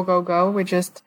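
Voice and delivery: ordinary talk, nothing marked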